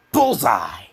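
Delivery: loud hammed-up shout; mock cheer. Reader mocking the scene with a cheer. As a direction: bark it like a game-show cheer.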